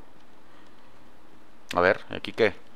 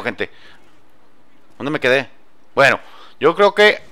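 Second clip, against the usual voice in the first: ordinary talk, nothing marked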